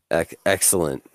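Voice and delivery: ordinary delivery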